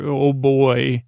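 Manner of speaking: ordinary talk, nothing marked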